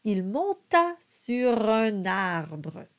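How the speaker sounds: slow, careful French: monta with a nasal O; slow French model. Shown as a demonstration. Coach reads the verse slowly for students. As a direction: sound out each French syllable. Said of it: "the nasalized O sound".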